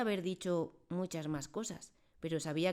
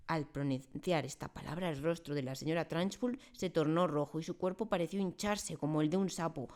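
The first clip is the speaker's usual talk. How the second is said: plain reading voice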